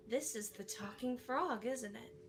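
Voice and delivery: softly voiced, awed question; hushed amazement. Director, hand over mouth, amazed.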